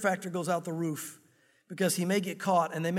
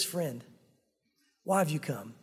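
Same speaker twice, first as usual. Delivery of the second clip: tender greeting; 6 semitones lower than usual. Acted. Jesus greeting Judas, low and gentle.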